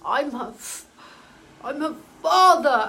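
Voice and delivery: stammering, building to a loud cry on father; a voice forcing its way out, then bursting loud; channeled father struggling to speak. Acted. Dead father speaking through the medium, stammering then loud. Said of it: "It was like he was talking through me".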